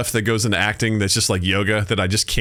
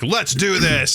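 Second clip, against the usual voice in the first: higher-pitched rallying cry at normal volume; mock eager battle readiness; retold gung-ho eagerness; 7 semitones higher, slower than usual. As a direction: pitch it up, raring to go. Retold eager cavalry charger.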